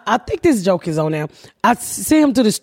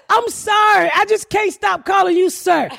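much higher, giggly imitated agent; mock amused apology; 9 semitones higher than usual. Airline agent keeps calling a woman sir, imitated amused apology. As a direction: apologize as if trying not to laugh, high-pitched. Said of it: "she started laughing like".

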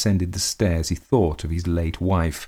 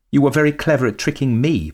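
high, louder, lightly mocking lady; mocking countess; 9 semitones higher, 6 dB louder, faster than usual. A narrator's reading. Countess taunting her husband about his tricks. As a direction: taunt him sweetly from up high. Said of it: "remarked the Countess".

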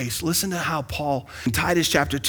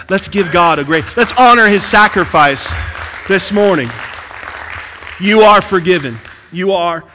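louder preaching call to honor God; rousing preacher; 3 semitones higher, 7 dB louder than usual. Acted. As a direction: lift the church to praise.

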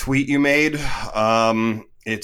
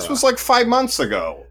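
pitched way up in disbelief, a little louder; incredulous; 12 semitones higher, 4 dB louder than usual. Acted. Host rebuts cohost's 'different era' excuse, high incredulous protest.

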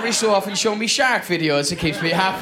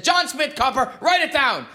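pitched-up, brazen repeat of JOHN SMITH; defiant insistence; 5 semitones higher than usual. Acted. Cyclist lying to a doubting policeman, brazenly defiant. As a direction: insist on it, high and cocky.

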